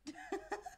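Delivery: high bright burst of laughter. Real, not acted. Laughter at toddler's antics.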